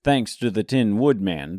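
ordinary delivery, nothing marked

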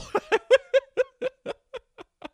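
loud, high laughing fit; helpless real laughter at oneself. Real, not acted. Laughs at how they looked.

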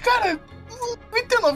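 ordinary talk

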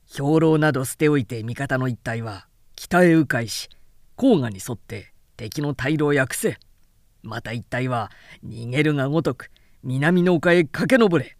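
low, firm battle orders; a general barking out orders; commanding Cao Cao. A narrator's reading.

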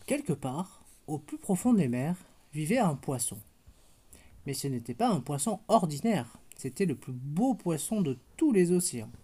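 put-on gentle storybook narration for a toddler; picture-book reading voice; bedtime story reading. A narrator's reading. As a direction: read it like a bedtime tale to a little girl. Reads bedtime story to child.

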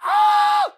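sudden loud high scream of pent-up rage; frustrated scream. Real, not acted. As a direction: let out a raw, loud yell of frustration. Venting pent-up frustration on podcast.

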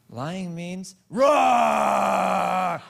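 high, slow mock lion roar, loud; a little girl roaring like a lion to her dad; toddler roar. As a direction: stretch "roar" into a big childish roar.

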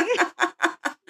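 light high burst of laughter. Real, not acted. Laughing at own story.

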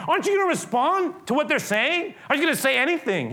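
high, loud pressing questions; frustrated Pilate. Acted. Pilate pressing silent Jesus, high and loud. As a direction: demand an answer, losing patience. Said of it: "He's basically saying".

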